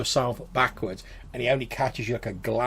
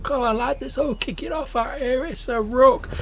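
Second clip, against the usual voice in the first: put-on much higher, rowdy drunk bloke; drunken lad rallying his mates; drunk pub regular; 14 semitones higher, 4 dB louder than usual. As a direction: call out like a sozzled regular. GM voices drunk man in pub.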